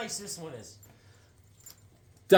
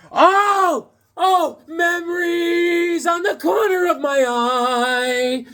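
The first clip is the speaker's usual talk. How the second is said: excited shout; 11 semitones higher, 3 dB louder, much slower than usual. Real, not acted. Excited comic find, nostalgic cry.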